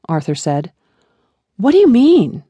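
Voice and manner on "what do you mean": higher, louder alarmed question. A narrator's reading. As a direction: ask it in rising panic. Daughter panicking on the phone.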